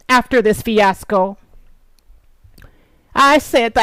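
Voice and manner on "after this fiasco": normal talking voice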